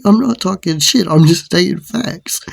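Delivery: high, loud retold protest; re-enacted high-pitched retort; defiant comeback. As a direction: answer back in acted defiance.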